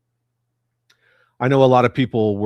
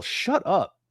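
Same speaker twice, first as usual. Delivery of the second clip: mock fanboy; 10 semitones higher than usual. Mock fan continues.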